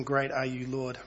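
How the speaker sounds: normal talking voice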